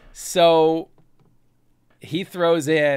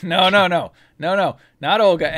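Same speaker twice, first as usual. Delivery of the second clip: alarmed protest; 5 semitones higher, 8 dB louder than usual. Real, not acted.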